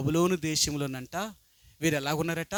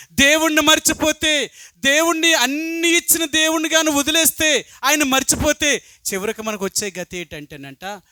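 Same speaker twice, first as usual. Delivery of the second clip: high, loud pulpit preaching; fiery preaching; 9 semitones higher, 9 dB louder than usual. Acted. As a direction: drive the point home to the congregation, much louder and higher. Preacher at full force. (ఉద్రేకంతో బిగ్గరగా ప్రసంగం)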